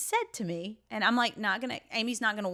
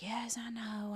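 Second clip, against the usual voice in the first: hushed whisper, much softer; whispered reply; 3 semitones higher, 8 dB quieter, slower than usual. Retold or imitated. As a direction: whisper it like a sheepish admission. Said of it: "And I was just like".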